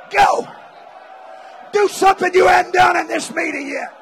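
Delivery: ordinary delivery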